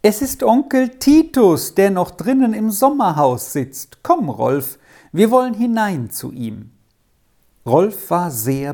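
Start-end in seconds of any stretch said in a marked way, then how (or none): 0.0-6.7 high, lively burst of recognition; merry exclamation. A narrator's reading. Dora discovers Onkel Titus in the summerhouse, merry and high. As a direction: call out cheerfully to a playmate. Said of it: "lachte Dora lustig auf".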